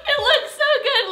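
high, loud delighted shriek; excited scream. Real, not acted. Girl revealing her new haircut to viewers, shrieking with joy.

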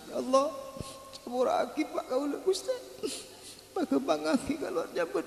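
high, soft, sobbing plea; crying child. Retold or imitated. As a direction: beg forgiveness through tears. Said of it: "anak si maca pas nangis".

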